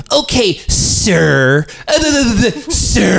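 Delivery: high, drawn-out mock politeness; mocking sir. Angry caller mocking the agent, exaggerated slow delivery. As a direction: throw the honorific back, slowly and derisively. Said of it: "giving it back to me".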